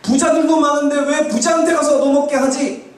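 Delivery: high-pitched mock complaint to God; acted protest. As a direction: protest upward, demanding an explanation. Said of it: "항의를 할 수 있어요".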